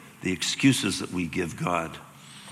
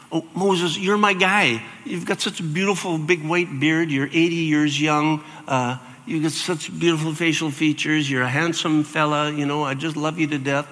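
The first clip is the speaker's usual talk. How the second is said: higher, gushing mock praise; mock flattering God; 6 semitones higher, 3 dB louder than usual. God mock-gushing over Moses in a higher gushing voice. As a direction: lay the flattery on thick.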